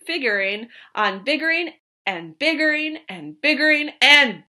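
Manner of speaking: loud angry yell; yelling mad. Acted. Onceler yells at the Lorax, furious and loud. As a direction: shout him down, furious. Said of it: "I got terribly mad. I yelled at the Lorax".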